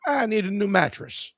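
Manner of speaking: high, dopey customer voice. Retold or imitated. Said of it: "looks at me and goes".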